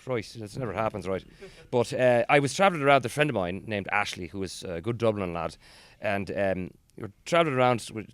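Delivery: lilting put-on Irish; Irish accent. Showing a favourite accent with a travel tale, Irish accent. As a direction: chat away in an Irish brogue.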